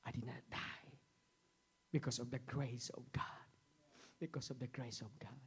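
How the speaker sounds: whispered. Acted. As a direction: breathe it out in awe. Quiet whisper after climax.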